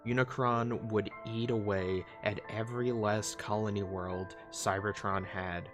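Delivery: usual speaking voice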